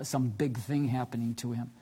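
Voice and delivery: ordinary talk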